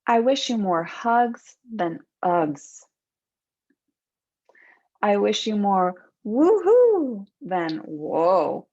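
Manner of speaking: normal talking voice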